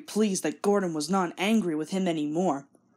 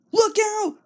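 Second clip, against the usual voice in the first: much higher, louder warning cry; 13 semitones higher, 5 dB louder than usual. Acted. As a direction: yell a warning of danger. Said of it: "cried the driver".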